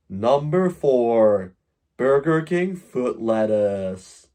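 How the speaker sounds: very slow, drawn-out announcer voice; mock slow BBC announcer. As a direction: read each word slowly like a solemn broadcaster. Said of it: "that's a development of the B B C accent".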